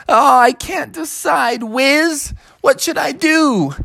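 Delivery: high, pleading bear cub voice; the bear begging for help; fretting little bear. Acted. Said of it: "And he said".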